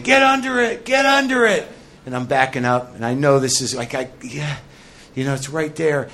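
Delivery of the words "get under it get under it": coach calling out. Retold or imitated. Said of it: "The coach is saying".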